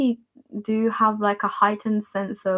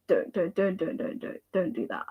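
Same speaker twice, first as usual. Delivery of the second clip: soft, low string of don'ts; hushed dissuading; 3 semitones lower, 8 dB quieter than usual. Acted. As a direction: gently wave it off, voice dropped. Waves off imagined self-blame.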